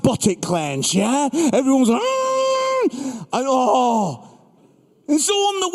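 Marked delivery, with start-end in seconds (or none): 2.0-3.3 high tight awkward "Mm"; embarrassed hum. Retold or imitated. Room reacting in embarrassment.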